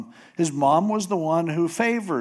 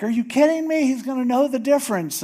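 much higher, disbelieving squeal; incredulous protest; 10 semitones higher, faster than usual. Retold or imitated. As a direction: squeal your protest at mom. Jacob objects again.

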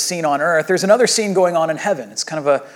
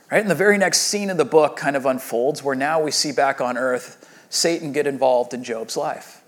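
ordinary delivery

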